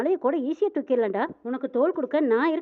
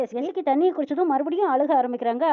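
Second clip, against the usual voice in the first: ordinary delivery, nothing marked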